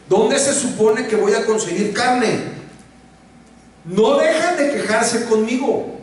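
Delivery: high, pleading, exasperated reading; exasperated Moses. Retold or imitated. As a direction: voice a leader at the end of his rope. Queja de Moisés leída. (Moisés exasperado, agudo)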